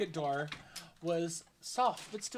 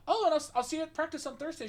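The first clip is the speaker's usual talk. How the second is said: cheerful goodbye; 10 semitones higher, 5 dB louder, faster than usual. Retold or imitated. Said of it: "I was like".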